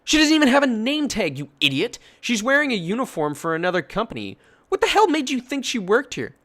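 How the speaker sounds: outraged mother yelling. Retold or imitated. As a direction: yell at her foolishness. Hero yells at the customer.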